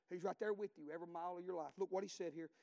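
ordinary talk, nothing marked

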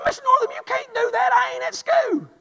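put-on high, pleading kid voice, as the boy he was; pleading schoolboy.

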